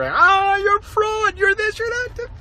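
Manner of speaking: high mocking voice; sneering imitation of hecklers; mocking critics. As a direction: mimic the whiny critics high. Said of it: "your little tiny notes to me".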